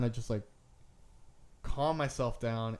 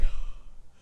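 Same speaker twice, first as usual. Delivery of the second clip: loud, laboured exhale; short of breath; 7 dB louder than usual. Retold or imitated. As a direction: act out a heavy, breathless sigh. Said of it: "not able to breathe almost".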